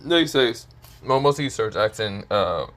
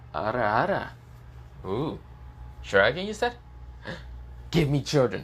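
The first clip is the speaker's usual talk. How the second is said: flirty tease; 3 semitones higher than usual. Acted. Kuroka teasing, flirty voice.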